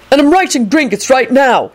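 high-pitched mild bellow. A narrator's reading. Neville bellows down the hall for his toad's benefit. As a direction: call it down the hall. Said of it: "he bellowed down the hall".

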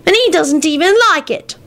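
put-on high, sulky little boy; petulant high kid voice; sulking child. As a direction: protest in a child's sulk. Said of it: "sulked Teddy".

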